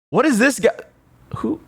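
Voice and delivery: sudden very high what; startled squeak. Real, not acted. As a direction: jolt up high in disbelief. Sees a strange character picture.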